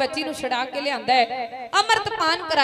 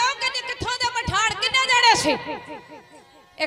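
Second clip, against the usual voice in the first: high, loud, slowly drawn-out questioning; loud demand; 9 semitones higher, 3 dB louder than usual. A narrator's reading. Commander demanding answers from the wedding party, loud and slow. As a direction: call it out so the hall rings. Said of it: "ਉੱਚੀ ਆਵਾਜ਼ 'ਚ ਕਹਿੰਦਾ".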